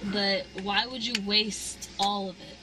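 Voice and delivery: ordinary talk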